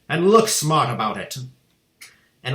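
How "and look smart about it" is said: loud, commanding shout. A narrator's reading. Headmistress ordering the boy onto the stage, shouting. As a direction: order him up with a bellow. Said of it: "the Trunchbull shouted".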